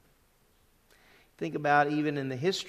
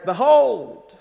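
Behold called out high and loud; a loud, high proclaimed "Behold"; emphatic behold; 7 semitones higher, 12 dB louder than usual. Shown as a demonstration. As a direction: ring out "Behold" like a herald. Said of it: "That's what the emphasis is".

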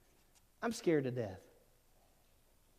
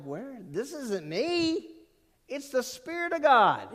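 amazed; 9 semitones higher, 4 dB louder, slower than usual. Retold or imitated. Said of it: "I'm like".

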